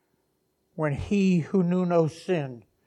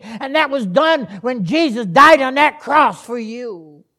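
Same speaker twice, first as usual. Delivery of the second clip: loud, raised preacher's voice; forceful preaching; 7 semitones higher, 13 dB louder than usual. Real, not acted. Preacher corrects the congregation on forgiveness, forceful preaching. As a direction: drive the point home at full force.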